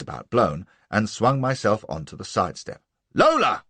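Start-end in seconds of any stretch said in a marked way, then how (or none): 3.1-3.6 high, loud roar of her name. A narrator's reading. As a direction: bellow her name from the step. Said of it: "I roared".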